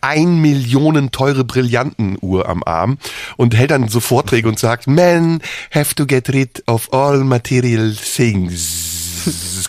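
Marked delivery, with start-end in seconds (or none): 4.9-9.7 put-on slow, heavy Indian English accent as Bhagwan. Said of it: "dass er diesen Akzent hatte".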